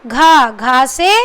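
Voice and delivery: घा stretched slowly with a full breathy gh; slow sound demo. Shown as a demonstration. Teacher sounding out a joined letter for pupils, slow and clear. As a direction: draw out घा, letting the gh breathe. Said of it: "क्या मिल गया मिलकर".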